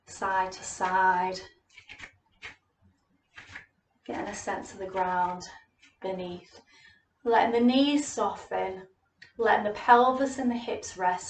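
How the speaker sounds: soothing yoga teacher voice; guided yoga cueing. Acted. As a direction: lead the class gently through the stance. Leads a gentle yoga practice.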